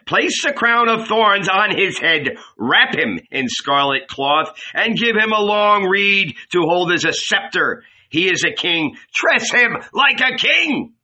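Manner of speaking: high-pitched, projected commands; giving orders. A narrator's reading. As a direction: issue orders to the guards, building to a cry. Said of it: "he ordered".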